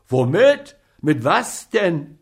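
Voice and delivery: high, loud demanding questions; frantic apothecary; alarmed shout. A narrator's reading. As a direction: bark the questions in panic.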